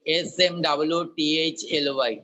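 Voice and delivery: "smoothly" spelled as S M double O T H L Y; spelling out. Shown as a demonstration. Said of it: "spells out smoothly".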